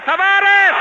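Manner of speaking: high, loud shout of the name. Acted. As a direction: yell his name as he shoots.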